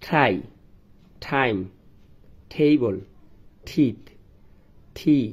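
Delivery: every initial t in Try, tea, tension breathed out like ঠ; aspirated t. Shown as a demonstration. Teacher drilling students on aspirated t words. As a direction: puff air on each starting t. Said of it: "টি এর উচ্চারণ হবে ঠ এর মতো".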